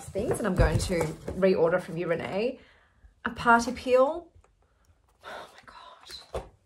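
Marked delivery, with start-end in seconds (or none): 5.2-6.5 slow breathless whisper; awed whisper. Real, not acted. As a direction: barely voice it, stunned. Overwhelmed by gifts.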